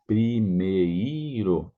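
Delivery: primeiro said slowly, every syllable clear. Shown as a demonstration. Teacher to student, slowly modelling primeiro. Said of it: "remember that, primeiro".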